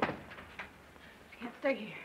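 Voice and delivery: slow, tense whisper; hushed whisper. Acted. To Roy who slipped into her room, a tense whisper. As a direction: breathe it so no one else hears.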